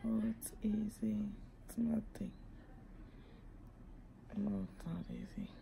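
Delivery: hurting, whispering through a sore mouth; barely voiced, in real pain. Real, not acted. Whispering in pain after surgery.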